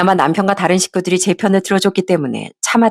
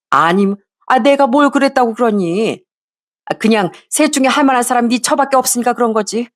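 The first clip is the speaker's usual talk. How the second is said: lightly voiced scolding mother-in-law, a bit higher; a hint of a sharp mother-in-law brushing off her son; 5 semitones higher than usual. Mother-in-law brushing off her son's complaint, a bit higher.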